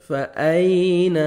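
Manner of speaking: فَأَيْنَ with the ي glided softly, slowly. Shown as a demonstration. Teacher shows the soft pronunciation, slow. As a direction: say فَأَيْنَ gently with a soft lean ي. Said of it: "نرمی سے ادا کریں گے".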